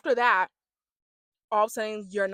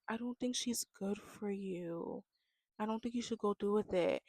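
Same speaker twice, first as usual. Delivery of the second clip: mock soft-spoken; 8 dB quieter than usual. Imitating Binda warning him off her, soft-spoken. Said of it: "they talk so soft".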